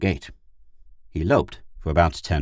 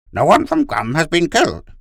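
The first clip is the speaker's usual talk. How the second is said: very high, loud dog character; insistent talking dog; 12 semitones higher, 8 dB louder than usual. Acted. Gur corrects Pid, insistent high dog voice. Gur contradicts his pilot.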